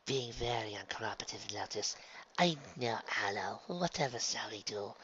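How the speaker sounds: soft, mock-helpless aside; quiet conferring. Spa twins discussing a difficult client, softly. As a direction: confer softly with your partner.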